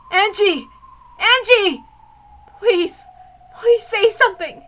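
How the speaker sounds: high, loud panicked calling; desperate shout. Acted. Mother screaming to her unconscious daughter, desperate. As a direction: scream her name to wake her.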